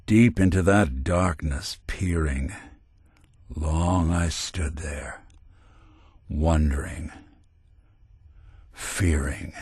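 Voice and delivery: slow, low, brooding recital with long pauses; poetry recitation. Acted. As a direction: recite it like dark verse, letting each phrase hang.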